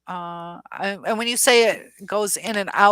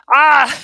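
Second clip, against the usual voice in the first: sudden loud high yelp of frustration; a sharp exasperated shout; frustrated cry; 8 semitones higher, 15 dB louder than usual. Real, not acted. Streamer fights her cramping hand while scrolling, frustrated yelp. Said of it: "This is so frustrating".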